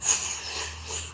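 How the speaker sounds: imitated rush of spraying fire; mouth-made flamethrower sound; flamethrower whoosh. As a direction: blow out a hissing flame noise.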